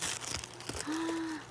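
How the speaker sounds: soft, high gasp of surprise. Real, not acted. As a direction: gasp quietly in wonder. Sees new item.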